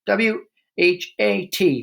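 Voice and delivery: high, slow squeal; a boy held by the ears squealing the spelling; squealed letters. A narrator's reading. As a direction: squeak out each letter in panic. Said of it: "he squealed".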